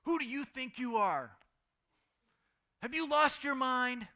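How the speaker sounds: loud, high mock-angry shout of a mother; imitating mom yelling. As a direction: bellow it like a fed-up mom scolding her kid. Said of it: "when my mom yelled at me".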